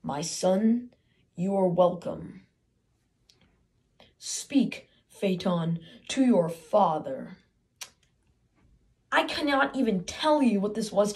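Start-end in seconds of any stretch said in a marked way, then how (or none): 0.0-7.4 lower, slow, stately god voice; grand father god. Retold or imitated. Boy voicing his father Apollo welcoming him, low and stately. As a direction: speak down to him like a sun god. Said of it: "He says".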